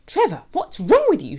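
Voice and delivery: high, loud cross shout. A narrator's reading. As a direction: shout it at the noisy dog. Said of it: "shouts Snookums".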